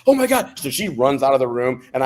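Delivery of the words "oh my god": high, loud panicked cry of alarm; startled nurse sees him faint, shrieks in alarm; alarmed gasp. Retold or imitated. Dietitian sees the patient about to faint, shrieks in high loud alarm.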